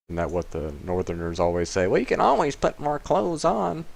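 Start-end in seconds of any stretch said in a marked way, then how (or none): 1.9-3.9 high mock Northern Yankee accent; badly faked Yankee accent, high and teasing; terrible Yankee accent. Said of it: "That was a terrible Yankee accent".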